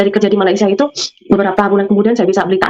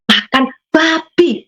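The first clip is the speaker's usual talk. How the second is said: son's voice; 7 semitones higher, much slower than usual. Retold or imitated.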